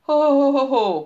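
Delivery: loud, high jolly Santa whoop. A narrator's reading. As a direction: let out a booming ho-ho like a seasoned Santa. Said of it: "rutinos télapó felfelkúrjantott". (harsány télapós kurjantás)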